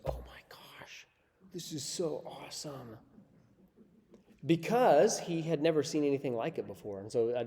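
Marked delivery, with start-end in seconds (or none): 0.0-3.0 put-on whispered, softer, in awe like a small boy; awed kid's whisper. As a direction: breathe it out amazed, like a kid seeing a stadium. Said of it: "he goes".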